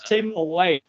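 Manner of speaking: garbled robotic call audio; breaking up like a robot. Real, not acted. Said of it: "we see you talking like a robot".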